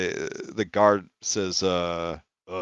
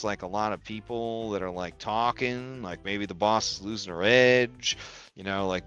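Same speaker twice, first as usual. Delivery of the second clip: ordinary talk, nothing marked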